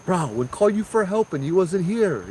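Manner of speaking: hammed-up gripe at a no-show friend; complaining to God, mock exasperation; mock exasperated complaint.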